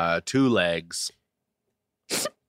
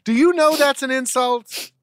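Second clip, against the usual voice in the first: much higher, fast put-on scolding voice; mock outraged. Co-host mock-scolding his friend for a crush, in a high put-on voice. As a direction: screech at him like a fussy housemate.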